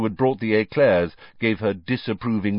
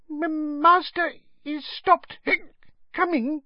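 very high, slow, hiccuping squeak; drunken little elf, stammering and hiccuping; tipsy house elf. A narrator's reading. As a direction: squeak it haltingly between hiccups. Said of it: "She swayed slightly again".

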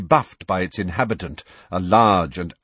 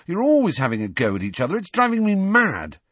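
angry outburst; 10 semitones higher than usual. A narrator's reading. Harry explodes at bickering friends.

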